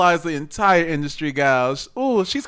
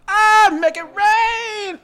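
high, loud, stretched-out wail; drawn-out mock cry; 5 dB louder, much slower than usual. Answering a friend's threat to pour water, hammed-up cry.

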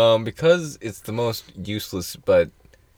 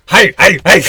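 high loud panicked hi hi hi; mock frantic greeting; 12 semitones higher, 14 dB louder, slower than usual. Imitating a guy shouting hi at French strangers, high and loud. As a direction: yelp it like a scared tourist. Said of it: "He's going".

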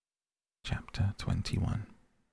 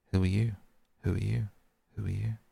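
fading echo; 2 semitones higher, faster than usual. A narrator's reading. Echo answering the prince, fading out. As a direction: echo it back, dying away. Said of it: "answered the echo".

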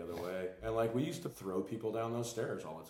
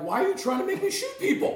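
much higher, louder squeal through laughter; laughing outrage; 10 dB louder than usual. Retold or imitated. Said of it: "I was like".

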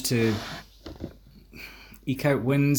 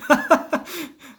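squealing laugh, much higher; high burst of laughter; 5 dB louder than usual. Real, not acted. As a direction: caught off guard, laughs up high. Laughing at the pompous jibe.

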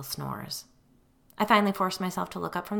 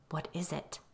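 hushed, much softer question; low voice; 9 dB quieter than usual. A narrator's reading. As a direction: ask under your breath so no one panics. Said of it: "I asked in a low voice".

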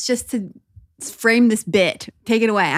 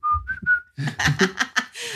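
high-pitched giggle burst; squealing laugh; 5 dB quieter than usual. Real, not acted.

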